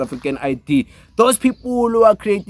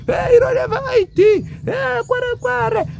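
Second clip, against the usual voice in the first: high, mocking sing-song jeer; mocking taunt. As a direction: jeer at them in a squeaky put-on voice. Mocking people who brag about IDs.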